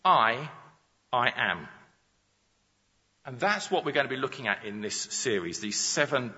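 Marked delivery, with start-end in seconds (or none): 0.0-1.7 first "I" louder and set apart, pause, then lower "I am"; emphatic. Shown as a demonstration. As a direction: punch "I", wait, then say "I am". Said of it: "Jesus uses this emphatic".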